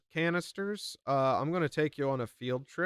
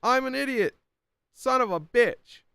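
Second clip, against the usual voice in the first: sudden high, loud cry of frustration; frustrated outburst; 11 semitones higher, 6 dB louder than usual. Real, not acted. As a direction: yell at yourself for the blunder, real annoyance. Streamer realises wrong video playing.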